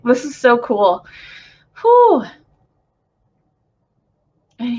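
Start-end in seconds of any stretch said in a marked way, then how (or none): 1.8-2.2 high, loud whew, letting the feeling out; emotional whew. Real, not acted. Moved channeler releasing emotion, high loud whew. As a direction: breathe out the overwhelm in a high rush.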